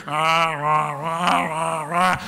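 low rhythmic rah-rah of a panicking herd; mock animal roar. Imitating wildebeest splashing into the river, low rah calls. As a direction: growl out the herd's noise in bursts. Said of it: "all of a sudden you hear".

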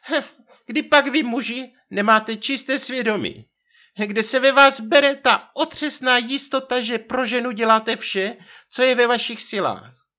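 high, loud theatrical wifely tirade; rozhořčená manželka. A narrator's reading. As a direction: rail at men in a put-on high voice. Narrator voices theatrical wife. (divadelně rozhořčený vysoký hlas manželky)

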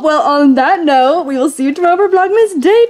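high, loud delighted squeal; slow down and soar up loudly to the finish; gushing sign-off. Real, not acted. Vlogger signing off until tomorrow's Vlogmas, gushing.

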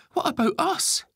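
put-on very high, loud child's question; clearly upset. A narrator's reading. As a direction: ask it as a hurt, upset boy. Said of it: "clearly upset".

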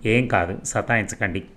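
ordinary delivery

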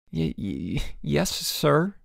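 tripping over the first word in shock; stammering. A narrator's reading. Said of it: "stammered Boots".

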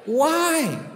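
high, slow teasing question; teasing the audience about visiting hell; mock incredulous. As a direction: stretch it out in playful disbelief. Teasing the congregation.

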